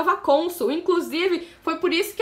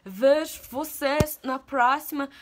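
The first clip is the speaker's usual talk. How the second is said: "Vejo vocês na próxima" drawn out very slowly in a borrowed foreign accent; amateur foreign accent, each word of "Vejo vocês na próxima" stretched; put-on accent; much slower than usual. Shown as a demonstration. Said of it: "gostado do meu sotaque".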